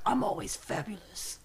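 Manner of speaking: soft, put-on self-assured impression; breezily vain horse voice; Swiftwind. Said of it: "because Swiftwind is like".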